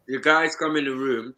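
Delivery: normal talking voice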